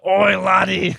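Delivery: loud hammed-up Scottish "Oi, laddie."; mock Scottish. Repeating the friend's joke in a mock Scottish accent. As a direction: bellow it in a cartoon Scottish accent. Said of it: "trying to impersonate the slang".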